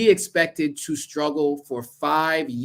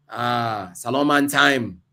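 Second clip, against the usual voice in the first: bad Sierra Leonean accent; 3 semitones lower, much slower than usual. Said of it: "so that's not a good accent".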